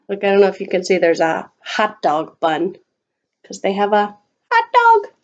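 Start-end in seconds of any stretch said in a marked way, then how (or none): none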